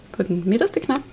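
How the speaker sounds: usual speaking voice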